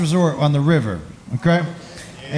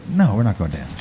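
much lower, deadpan no; a deep, flat older woman; deep-voiced woman shutting it down; low firm refusal; 13 semitones lower, faster than usual.

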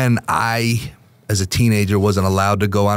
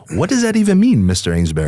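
incredulous; 5 semitones higher, faster than usual. Real, not acted. Host teasing the guest about the alleged story, incredulous. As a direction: ask it in playful disbelief.

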